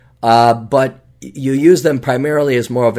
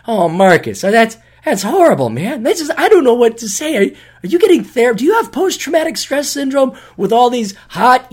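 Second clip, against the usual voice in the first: higher, hammed-up sarcastic sympathy; fake-console him over his lucky problem; overdone pity for a friend whose only trouble is too many girls; mock pity; 11 semitones higher than usual. Mocking friend's girl problem.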